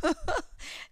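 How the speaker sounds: a short high burst of giggling; real excited giggle. Real, not acted. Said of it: "I don't know why I'm laughing and giggling".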